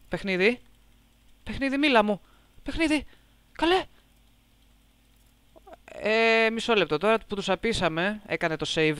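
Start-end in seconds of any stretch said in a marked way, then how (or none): 1.4-3.8 high-pitched whiny plea to the game; high pleading call. Real, not acted. (ψιλή παρακλητική φωνή)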